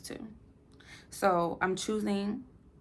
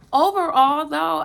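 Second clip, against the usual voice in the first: loud emphatic overall; 8 semitones higher, 12 dB louder than usual. Real, not acted. Vlogger to viewers, a loud emphatic overall. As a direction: burst out louder on overall.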